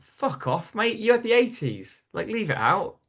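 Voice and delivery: mock-indignant brush-off; instant Geordie comeback. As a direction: fire back like an affronted Geordie. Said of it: "because I was a Geordie, I immediately just went".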